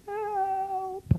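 very high, thin mock plea; hammed-up high-pitched help call; fake cry for help. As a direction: pretend to be scared at the door.

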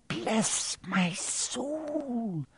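put-on soft, very slow, hushed old voice; awed whisper. Old bartender hushed at meeting Harry. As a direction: breathe it out in wonder. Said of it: "whispered the old bartender".

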